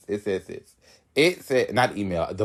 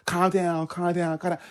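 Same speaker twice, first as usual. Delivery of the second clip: higher, softening mimicry of the manager; raised-pitch, insistent retold hushing; repeated calm-down; 5 semitones higher than usual. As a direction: imitate her repeating it, pitch lifted. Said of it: "She said".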